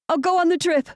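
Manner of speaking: high, loud, urgent. A narrator's reading. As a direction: blurt it out urgently. Decides urgently on phone.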